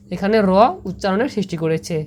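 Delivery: ordinary talk, nothing marked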